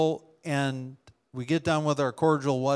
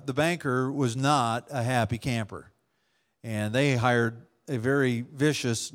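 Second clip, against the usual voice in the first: ordinary talk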